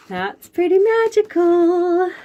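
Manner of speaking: high, loud, wide-eyed wonder; delighted gasp. Acted. Storyteller marveling to the children, high and loud. Echoing the magical gown, delighted.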